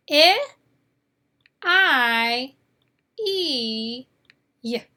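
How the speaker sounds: "I, I, E, Y" as ih, eye, ee, yuh, widely spaced; four vowel sounds. Shown as a demonstration. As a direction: isolate each sound in "I, I, E, Y". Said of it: "four vowel sounds".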